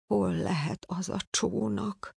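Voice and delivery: whispered under the breath; muttering. A narrator's reading. Worried father whispers to himself while searching.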